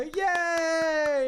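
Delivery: high, excited little cheer; mock cheer. Retelling the TikTok prank, a high excited cheer. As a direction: squeal it like a happy crowd.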